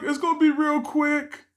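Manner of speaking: lightly voiced girlfriend brushing it off.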